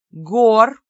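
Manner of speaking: громкий оклик по имени; окликни громко и повелительно; властный оклик. A narrator's reading. Госпожа резко окликает Гора. (громко, властно)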